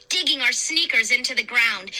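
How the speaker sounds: usual speaking voice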